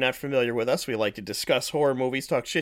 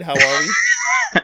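drawn-out greeting; 4 semitones higher, 9 dB louder, much slower than usual. Acted. Host greeting co-hosts, drawn out.

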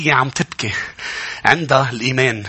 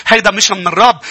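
ordinary delivery

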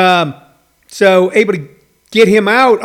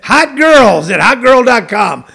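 louder, slow, cheesy ad read; spammy ad voice; 4 semitones higher, 5 dB louder than usual. Retold or imitated. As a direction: sell it like a sleazy ad. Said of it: "the comments are from some weird handle".

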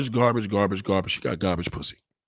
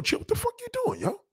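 very high startled yelp; alarmed yelp; 5 dB quieter than usual. Acted. Man stopping his girl mid-act, squeaking high. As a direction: squeak out the protest.

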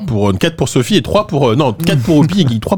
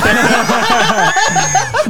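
high burst of laughter. Real, not acted. Laughs at sore loser joke.

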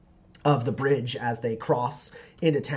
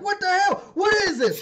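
high, loud demanding yell; acted alarmed shout; shouting man; 6 dB louder than usual. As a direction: shout in outraged disbelief. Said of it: "He shouts at you".

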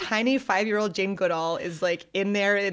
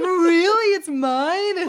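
high, loud delighted squeal; can't believe the gift is hers; girl amazed her uncle gave her a tree; thrilled child; 12 semitones higher, 8 dB louder, much slower than usual. Retold or imitated.

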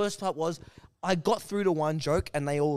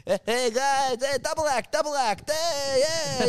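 high, put-on hyped stage voice; mock his excited calling to the crowd; high-pitched, hammed-up announcing; stage heckler impression; 8 semitones higher, 3 dB louder than usual. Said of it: "he goes up on stage and he's like".

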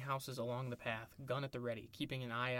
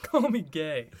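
laughing; 3 semitones higher, 12 dB louder, slower than usual. Real, not acted. Echoing the misheard line.

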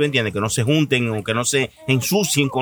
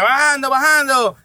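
lookout call; 9 semitones higher than usual. Retold or imitated. Lookout warns police are coming.

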